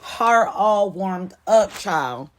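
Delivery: slow, high and loud, stretched out; a slow, loud sassy echo; sassy drawn-out repeat. Retold or imitated. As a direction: savor every word, sassy and tickled.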